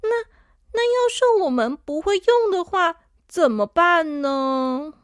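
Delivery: put-on worried little girl asking. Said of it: "小朵说".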